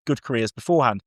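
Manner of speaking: ordinary talk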